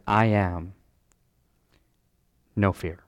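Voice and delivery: low, soft, spaced-out comfort; reassuring. Acted. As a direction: let each short phrase drop gently. Voices Jesus calming the disciples.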